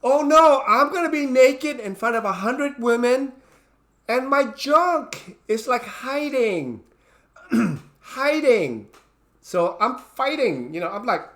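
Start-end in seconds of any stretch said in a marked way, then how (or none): 0.0-8.9 mock panic. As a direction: play the frightened thought big for laughs. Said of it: "that's frightening my head also too".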